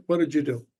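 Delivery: ordinary talk, nothing marked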